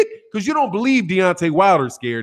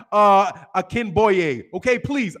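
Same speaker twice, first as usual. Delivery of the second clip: normal talking voice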